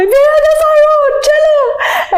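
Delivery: very high mock wail; imitated crying. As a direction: wail the directions like a bawling passenger. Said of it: "And I'd be crying".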